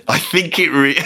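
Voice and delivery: struggling to read through giggles; words breaking up into laughter; helpless laughter. Real, not acted. Comedian attempting the next lyric, helpless laughter. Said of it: "I'm just laughing at it".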